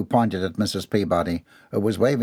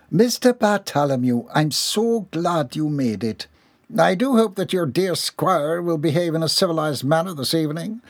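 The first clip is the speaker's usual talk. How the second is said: polite hostess; 6 semitones higher than usual. Acted. Hostess Missus Dixon greeting Mister Bartholomew, gracious and higher. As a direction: greet him with strained courtesy.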